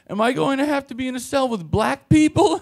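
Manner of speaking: high-pitched mock worry; mimicked fretful woman. Arrested woman asks officer about cell, mimicked high worry. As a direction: ask it high in her imitated voice.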